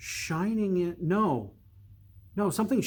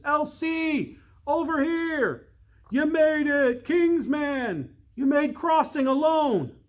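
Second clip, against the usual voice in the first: much higher, louder excited hails; excited calling; 11 semitones higher, 4 dB louder than usual. Acted. Workers calling out to the arriving boy. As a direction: hail him joyfully across the distance. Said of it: "solo voices".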